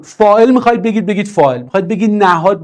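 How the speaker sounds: ordinary delivery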